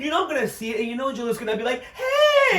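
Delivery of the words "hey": very high, loud drawn-out shout; hammed-up high-pitched holler of greeting; mock call-out. Host imagining J Lo seeing the show, mock call-out.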